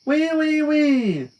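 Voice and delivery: high, loud pig squeals; scream-squeal like a pig. Acted. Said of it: "she kept right on screaming".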